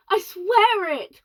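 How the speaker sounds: slow, high, loud pleading; desperate cry. Acted. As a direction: cry out to be believed. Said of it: "cried poor Shuckworth".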